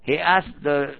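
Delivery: ordinary delivery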